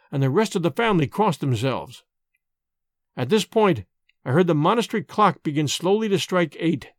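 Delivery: plain reading voice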